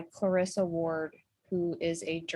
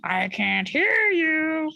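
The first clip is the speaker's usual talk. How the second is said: slow, high, loud pirate bellow; playful theatrical captain's shout; pirate captain call-out; 9 semitones higher, 6 dB louder, much slower than usual. Acted. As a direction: stretch it out to rouse the crew. Playing the pirate captain, quoting.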